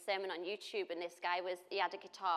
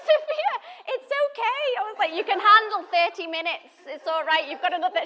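high, loud laughing reassurance; high laughing motherly reassurance; mock soothing mum; 12 semitones higher, 14 dB louder than usual. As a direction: comfort her daughter while laughing.

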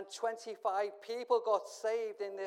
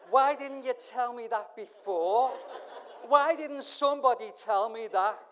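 loud laughing outcry; 6 semitones higher, 12 dB louder than usual. Real, not acted. Laughing joke about the glasses.

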